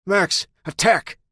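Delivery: high, loud, slow yelled order to the dog; yelled command. Acted. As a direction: yell it to send the dog in.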